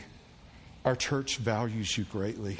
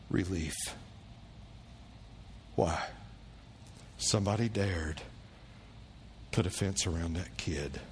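solemn and low; 5 semitones lower, 5 dB quieter than usual. Real, not acted. Pastor's solemn point.

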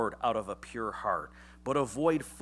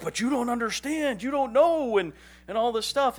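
high, loud whiny protest; mock protesting husband; 12 semitones higher, 9 dB louder than usual. Husband protesting to his wife, high, loud whine. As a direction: whine your defence, voice cracking high. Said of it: "I'll be like".

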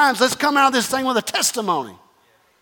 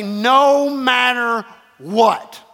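NO MATTER held long, WHAT set apart; drawn-out rallying "No matter what"; emphatic call; much slower than usual. Shown as a demonstration. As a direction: lead the crowd in a slow "No matter what". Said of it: "just shout and say this".

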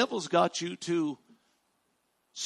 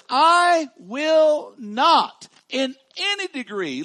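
"I will not in any degree" slow and loud, falling; emphatic promise; 11 semitones higher, 13 dB louder, much slower than usual. Shown as a demonstration. Pastor voicing God's promise, slow and emphatic. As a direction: stretch out "I will not" with full force. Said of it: "Three times he says".

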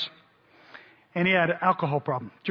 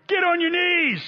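a much higher, louder armed command; mock yell; 11 semitones higher, 4 dB louder, slower than usual. Neighbor with rifle shouting at intruder, high mock yell. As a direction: bark it like a startled homeowner. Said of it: "They yell to him".

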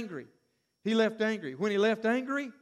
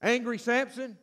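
normal talking voice

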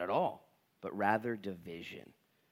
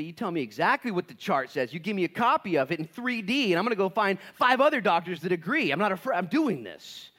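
louder, higher defiant demands; patient demanding the full chart; determined patient; 7 semitones higher, 7 dB louder than usual. Retold or imitated. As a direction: insist on the whole truth. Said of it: "No, you say".

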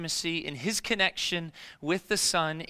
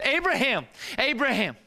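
a raised call, higher and louder, to halt him; 5 semitones higher, 3 dB louder than usual. Acted. Angel calls out to Abraham to stop the knife, loud urgent call. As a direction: call his name across the mountain to stop him.

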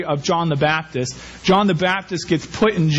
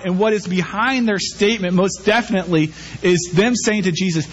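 ordinary talk

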